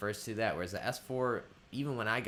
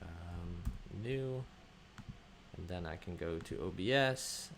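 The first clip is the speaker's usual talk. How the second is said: ordinary talk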